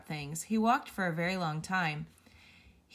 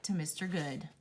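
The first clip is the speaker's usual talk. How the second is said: ordinary delivery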